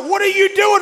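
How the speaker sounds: high, loud alarmed question; shocked cry of what are you doing; startled shout. Retold or imitated. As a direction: yell it like you've just been startled. Friend startled by being sprayed.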